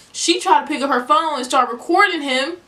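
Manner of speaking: rising outrage as the story peaks; outraged telling. Real, not acted. Telling listeners how the new girl filmed him, heated. Outraged retelling of coworker recording.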